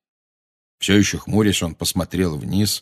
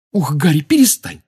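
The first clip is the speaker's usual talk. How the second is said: high, raised-voice exasperated rebuke; angry; 8 semitones higher, 5 dB louder than usual. A narrator's reading. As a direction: tell a friend off crossly. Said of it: "сердито сказала Гермиона". (сердито)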